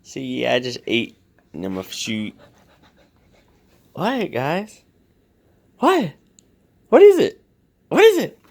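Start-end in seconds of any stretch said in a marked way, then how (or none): 3.9-8.4 much higher and louder questioning; squealing what-is-it to the dogs; excited pet talk. Real, not acted. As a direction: ask the dogs eagerly what's up. Asking barking dogs.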